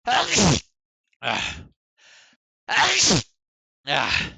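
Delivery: sharp breathy gasps before a sneeze; building up to a sneeze, fighting it; holding back a sneeze. Real, not acted. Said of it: "I'm gonna sneeze".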